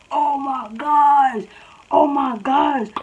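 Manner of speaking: high, drawn-out oohing voice; mock crowd reaction. Classmates react as she laughs at the girl, mock-scandalized. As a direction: imitate onlookers stirring up drama, slowly.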